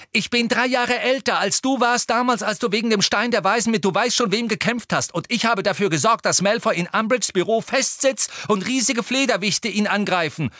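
high, loud indignant tirade; angry interruption. A narrator's reading. Ginny interrupting Harry to argue her case, angry tirade. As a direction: cut in hotly and barrel on. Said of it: "Ginny warf zornig dazwischen".

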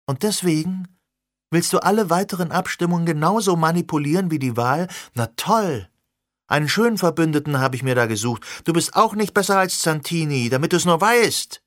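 high, indignant reproach; angry. Acted. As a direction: lash out in disbelief. Sirona furious at Alex.

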